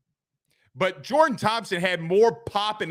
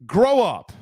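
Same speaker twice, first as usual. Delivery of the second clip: loud exasperated scold, higher; grow up; 4 semitones higher, 5 dB louder than usual. Real, not acted. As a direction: snap it at the fans, fed up. Repeats scolding fans.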